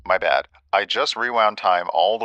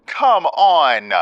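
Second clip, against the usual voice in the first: much higher and louder, exasperated; frustrated yell; 10 semitones higher, 7 dB louder than usual. Real, not acted. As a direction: shout it at the screen.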